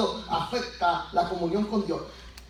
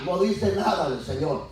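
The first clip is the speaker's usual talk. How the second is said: ordinary delivery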